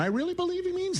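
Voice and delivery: squeaky very high voice; high register voice. Acted. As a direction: shoot up into falsetto. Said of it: "high register take".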